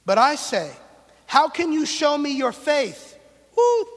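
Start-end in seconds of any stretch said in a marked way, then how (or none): 1.3-3.2 slow, loud, pointed question; loud challenge. Acted. Preacher challenging a listener who claims goodness, loud and slow. 3.5-3.9 sudden high yelp of surprise; startled whoop. Real, not acted. Preacher startled by a ladybug mid-sermon, high yelp.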